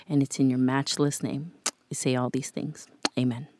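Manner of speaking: soft, earnest prayer voice. Acted. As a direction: pray it gently and sincerely. Closing prayer over listeners.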